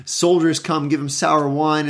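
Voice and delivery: ordinary talk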